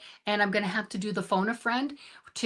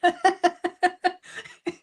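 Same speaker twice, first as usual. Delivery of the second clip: a quick, high sheepish laugh; short embarrassed laugh; 9 semitones higher, 3 dB louder than usual. Real, not acted. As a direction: laugh it off at the tech mess. Laughing at her own tech trouble.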